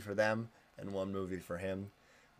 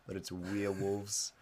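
New Zealand accent; 5 dB quieter, slower than usual. Shown as a demonstration. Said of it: "in a New Zealand accent".

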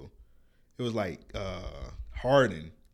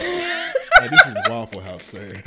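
laughing; 9 dB louder, slower than usual. Pretend. Mock waitress greeting, laughing.

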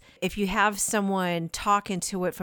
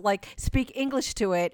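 ordinary talk

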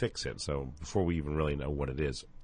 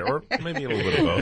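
laughing; 9 semitones higher, 5 dB louder, faster than usual. Real, not acted. Joking about margaritas.

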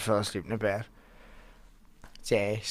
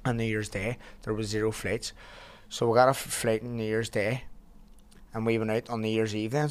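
normal talking voice

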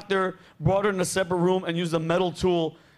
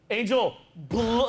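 normal talking voice

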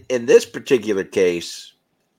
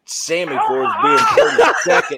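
high, laughing through the excuse; giggling comeback; laughing defence; 7 semitones higher than usual. Real, not acted. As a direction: defend yourself while cracking up.

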